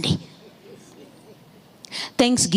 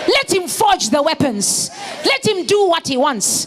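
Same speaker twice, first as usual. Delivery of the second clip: preaching at full force; 9 semitones higher than usual. Real, not acted. Pastor rousing the congregation, full-force preaching. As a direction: shout it out like a fired-up preacher.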